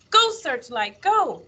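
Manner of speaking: high, eager cheer to a dog; urging on. A narrator's reading. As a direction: cry out to spur the dog on. Willie urges on his dog.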